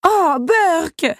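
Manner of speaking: high-pitched yelp of disgust; disgusted exclamation. A narrator's reading. Belle splashed with dirty water by the beast, exclaiming in disgust. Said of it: "s'exclama-t-elle".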